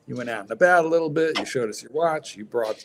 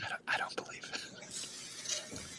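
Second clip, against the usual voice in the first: whisper; 23 dB quieter, much slower than usual. Real, not acted. Whispered aside during chat.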